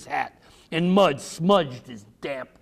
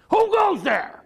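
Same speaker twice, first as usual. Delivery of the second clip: high, loud challenge; father challenging a stranger at the door; father calling out; 5 dB louder, slower than usual. A narrator's reading. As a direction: call it through the door. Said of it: "Father asked".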